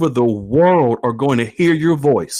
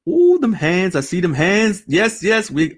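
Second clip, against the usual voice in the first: normal talking voice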